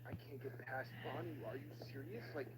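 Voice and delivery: ordinary talk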